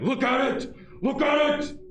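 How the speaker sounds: high shouted cartoon voice; Patrick shouting. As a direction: yell it like Patrick in the theater. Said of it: "and he's like".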